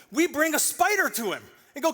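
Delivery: ordinary delivery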